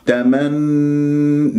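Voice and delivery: very slow "تمن" held on a long nasal n; complete ghunna. Shown as a demonstration. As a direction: hum out the doubled n of "تمن". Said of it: "نون مشددة الغنة كمل ما تكون".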